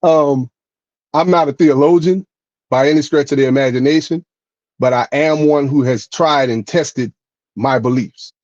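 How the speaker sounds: ordinary delivery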